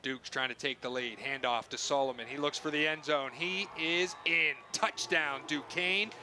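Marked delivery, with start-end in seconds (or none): 3.3-6.1 drawn out excited touchdown call. Real, not acted. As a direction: sell the score to the crowd.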